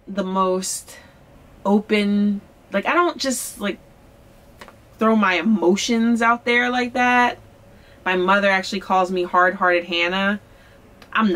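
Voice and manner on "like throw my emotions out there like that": normal talking voice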